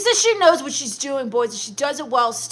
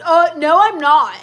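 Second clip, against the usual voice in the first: loud, emphatic denial; offended, louder comeback; indignant denial; 7 dB louder than usual. Acted. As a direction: push back hard at the accusation. Denying accusation of faking age.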